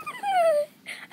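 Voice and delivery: very high happy squeal; high, whimpering squeal of delight; overjoyed squeal. Real, not acted. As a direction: let out a tiny high squeal of joy. Overjoyed at the new toy.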